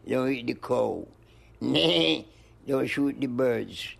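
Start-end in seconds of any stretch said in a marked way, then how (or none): 1.6-2.2 drawn-out, higher goat's "meh"; goat bleat. Retold or imitated. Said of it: "go meh".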